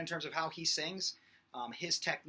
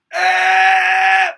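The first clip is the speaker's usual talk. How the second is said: high, loud forward eh; grunted scream; 24 dB louder than usual. Shown as a demonstration. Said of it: "He would do".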